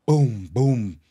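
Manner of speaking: ordinary talk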